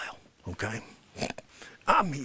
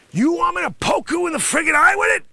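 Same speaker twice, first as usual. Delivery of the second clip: high, loud hammed-up threat; mock angry shout; 10 semitones higher, 7 dB louder than usual. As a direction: yell the threat.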